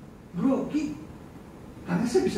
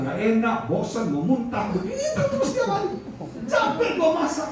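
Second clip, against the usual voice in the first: blunt complaint; 8 semitones higher than usual. Retold or imitated. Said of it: "Saya bilang".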